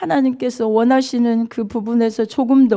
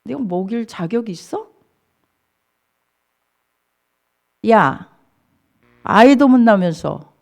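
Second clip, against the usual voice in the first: contemptuous taunting; 4 semitones lower than usual. Acted. Rival wife mocking the childless Hannah, contemptuous. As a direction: act out a rival belittling her, jab by jab. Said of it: "엄청 무시했어요".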